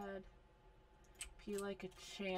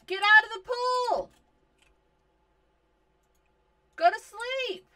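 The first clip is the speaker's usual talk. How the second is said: higher and louder, shouting at the screen; exasperated yelling; 12 semitones higher, 12 dB louder than usual. Real, not acted. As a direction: yell at the sims like an exasperated parent. Yelling at disobedient sims.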